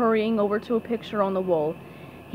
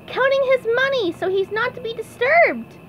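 very high, chirpy cartoon voice; SpongeBob; 12 semitones higher than usual. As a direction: answer in a squeaky little pipsqueak voice. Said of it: "answered SpongeBob".

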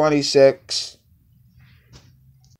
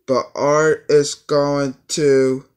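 slow dramatic; 3 semitones higher, 4 dB louder, slower than usual. Acted.

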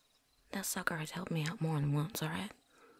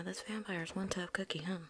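ordinary delivery

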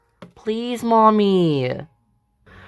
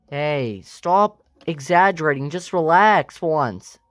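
low, dismissive father telling them to calm down; dad voice; 8 semitones lower, slower than usual. Acted. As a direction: sink into a flat-out fed-up dad. Dad scolding dramatic family.

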